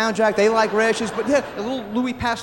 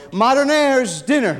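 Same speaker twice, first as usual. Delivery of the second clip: drawn-out call to the dinner table; 4 dB louder, slower than usual. Acted. Father calling quadruplets in for dinner, calling out. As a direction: holler the kids in for dinner. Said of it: "call them in like that".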